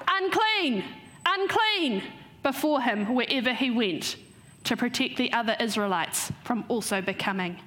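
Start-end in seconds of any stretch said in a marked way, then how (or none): 0.1-2.0 high-pitched cry with a falling end; mock shout. Acting out an outcast forced to shout before him, mock shout. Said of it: "He'd have been forced to shout".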